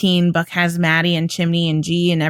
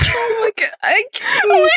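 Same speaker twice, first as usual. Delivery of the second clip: helpless laughter. Real, not acted. Host in a real laughing fit over the name. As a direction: lose it completely, voice cracking.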